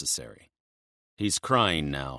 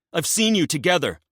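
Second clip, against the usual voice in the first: desperate blurt; 12 semitones higher, 7 dB louder, faster than usual. Acted. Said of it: "he blurts out".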